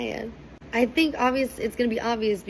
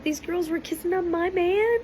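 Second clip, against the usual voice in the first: high-pitched disbelieving question; mock indignant; 11 semitones higher than usual. Islander about girls kissing her man, mock indignant. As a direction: act out a jealous girlfriend's disbelief.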